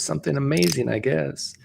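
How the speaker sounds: high-pitched kid impression; little kid. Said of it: "it's the scene where the little kid".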